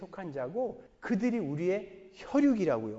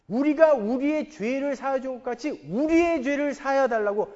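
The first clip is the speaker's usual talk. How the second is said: louder, higher pulpit preaching; preaching at full force; 5 semitones higher, 6 dB louder than usual. Acted. Pastor urging the congregation to live for "우리", preaching at full force. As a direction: drive home the point on "우리".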